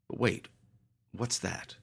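hushed, wary question. A narrator's reading. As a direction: ask it under your breath. Narrator spots something eerie.

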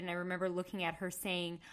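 usual speaking voice

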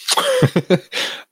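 sudden high-pitched burst of laughter. Real, not acted. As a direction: break into a squeaky laugh.

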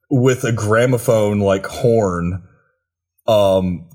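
ordinary talk, nothing marked

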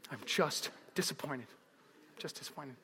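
low, hushed, let-down voice; grave parental disappointment. Retold or imitated. Parents after the car is totaled, hushed disappointment. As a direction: let it sink almost to nothing.

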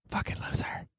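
sudden unvoiced whisper. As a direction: hiss the insult under the breath. Insult dropped to a whisper.

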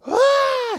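put-on high pained kid scream; imitated scream. Pastor imitating the tripped boy's high scream. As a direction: mimic a hurt boy screaming. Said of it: "I start screaming".

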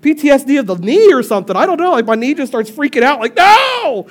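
high, loud hammed-up panic; husband in car, mock panicked whine; mock freak-out. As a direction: complain to Chris like the knee's possessed. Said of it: "look at Chris and go".

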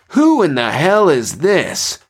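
high, drawn-out indignant shout; surprised, angry shout. A narrator's reading. Pilot startled by a stranger on the line, shouting angrily. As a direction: demand it, startled and angry, stretching the words. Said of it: "Paul shouted, both surprised and angered".

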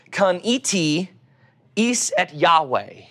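Hebrew read in an Oklahoma accent; slow Hebrew with an Oklahoma accent. Shown as a demonstration. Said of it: "with my Oklahoma accent".

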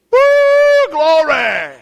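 high, loud whoop of joy; excited church shout. Retold or imitated. Old church lady whooping in the Spirit, loud and high. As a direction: shout it out like a sister catching the Spirit. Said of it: "let the steam out".

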